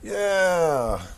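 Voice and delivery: high excited yelp; happy cheer. Acted. Teacher voicing a jumping happy figure, high cheer. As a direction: leap up with a joyful whoop. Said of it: "When you're happy".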